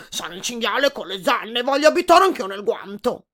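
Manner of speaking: a big boar insisting on his place; pushy wild boar. A narrator's reading. Boar demanding to move into the glove, loud and pushy.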